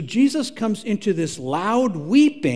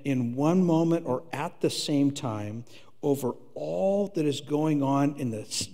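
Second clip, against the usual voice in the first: hushed sermon; 6 dB quieter than usual. Acted. Pastor softly tells the church how Jesus weeps, hushed and tender. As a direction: draw the congregation in gently. Quiet tender preaching passage.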